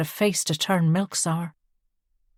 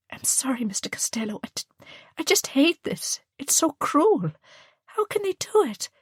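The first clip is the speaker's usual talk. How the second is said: starts hushed, then breaks high and tearful; a young nun fighting back tears, rising to anguish; the nun confides her distress to the photographer through tears; 9 semitones higher than usual. A narrator's reading. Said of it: "he realised the nun was crying".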